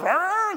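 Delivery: high yappy bark-growl; imitated dog barking. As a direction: yap like a fired-up dachshund. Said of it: "They're going and barking".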